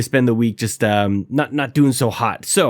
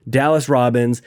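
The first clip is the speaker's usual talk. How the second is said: ordinary talk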